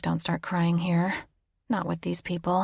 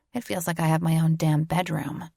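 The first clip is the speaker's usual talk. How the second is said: ordinary delivery, nothing marked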